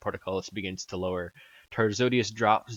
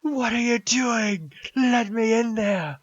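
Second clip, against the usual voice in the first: high, drawn-out desperate scream; loud, high-pitched shriek of protest; 7 dB louder, slower than usual. Acted. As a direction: scream to be let in, stretching the words. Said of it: "Tarzodius kinda starts screaming".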